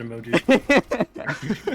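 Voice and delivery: slow, loud, stretched-out mockery; mocking drawn-out tease. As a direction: drag it out to roast a nerd. Teasing friend's pedantry.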